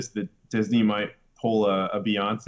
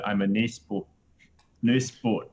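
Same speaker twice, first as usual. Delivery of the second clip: quick mock Kiwi "nice boy" with "nice" said like "nes"; mock a New Zealand robot saying "I'm a nice boy"; New Zealand accent. Cohost mocks IG-11 in a New Zealand accent. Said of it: "in New Zealand accent".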